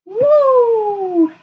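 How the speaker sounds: high loud "woo"; excited cheer. Real, not acted. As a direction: cheer with a shout. Cheering in sock basketball.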